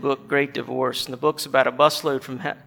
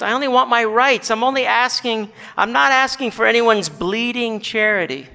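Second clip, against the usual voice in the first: higher, insistent demand; indignant ghost; 6 semitones higher, 3 dB louder than usual. Acted. Ghost refuses charity from the bright man, indignant. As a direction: protest proudly in a raised voice.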